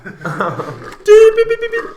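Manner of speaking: high-pitched rapid beeping; imitated electronic beeps. Friend mimics an antenna picking up words, high beeps. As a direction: beep very high like a machine.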